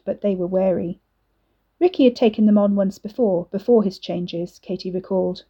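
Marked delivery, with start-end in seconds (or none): none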